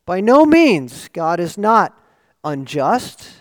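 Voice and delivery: forceful declaration. Acted. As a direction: proclaim it with full force. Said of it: "as forcefully as he can say it".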